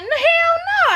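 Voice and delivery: mock high-pitched loud outburst; emphatic denial. Man denying to Scrappy he wanted to go, mock emphatic denial. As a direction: exclaim it high and loud, as a retold protest.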